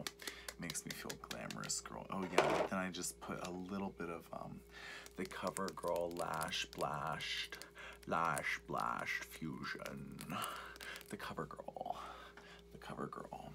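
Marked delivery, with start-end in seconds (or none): 0.1-2.1 sassy low repeat. Shown as a demonstration. 5.2-10.2 slow drawn-out. Shown as a demonstration.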